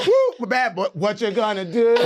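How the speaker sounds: high, loud whoop into a chant; whoop, then holler it through laughter; loud laughing sing-out; excited hollering. Retold or imitated. Acting out the shouted warning exchange.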